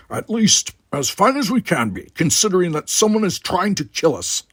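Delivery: dry grim warrior; Worf's voice. Acted. Worf answering Zach under attack, grim and loud.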